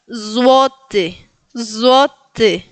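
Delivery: złoty as buzzed ZWO-te; Polish pronunciation. Shown as a demonstration. Teacher naming the Polish currency, careful model word. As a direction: stretch złoty, ł as w. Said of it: "tienen que decirlo en polaco".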